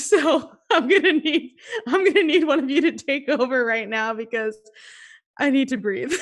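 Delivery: laughing while talking; giggling through the words. Real, not acted.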